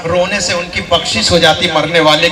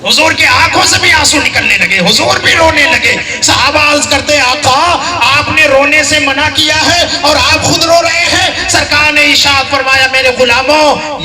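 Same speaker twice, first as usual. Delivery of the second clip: loud, high impassioned sermon; raised preacher's voice; fiery preaching; 9 semitones higher, 4 dB louder than usual. Acted. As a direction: build it up like a pulpit climax. Preacher at full force.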